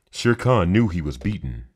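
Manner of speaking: ordinary delivery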